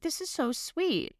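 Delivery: high, soft, slow swooning; gushing. Retold or imitated. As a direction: melt over something adorable. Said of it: "I'm like".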